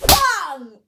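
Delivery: loud, high "bang" burst; imitated slap impact. Storyteller mimics the second slap, explosive impact noise. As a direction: punch out a slap sound effect. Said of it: "Second slap".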